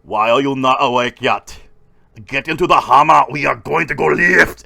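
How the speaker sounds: booming Austrian strongman impression; loud, commanding mock Austrian voice; Arnold. Bodybuilder at everyone's door rousing them to lift, booming. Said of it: "he'd come to everyone's door going".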